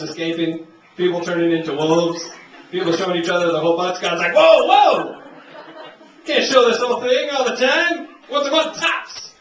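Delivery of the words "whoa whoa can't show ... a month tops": loud, high alarmed God. Acted. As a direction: shout to stop the madness. God stopping moon chaos.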